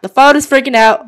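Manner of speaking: loud, alarmed exclamation; panicked alarm. Real, not acted. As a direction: blurt it out in rising panic. Game phone suddenly glitches.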